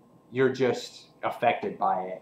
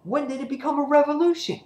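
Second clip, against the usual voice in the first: much higher, fast put-on voice; mocking incredulous voice; 4 dB louder, faster than usual. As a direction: scoff at the word like it's absurd. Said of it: "it says".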